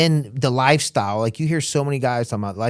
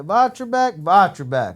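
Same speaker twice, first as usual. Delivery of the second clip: high called-out name, teasing; higher, playful taunt; mock sheriff calling out; 6 semitones higher, slower than usual. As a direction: call his name across the bar. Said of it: "He's like".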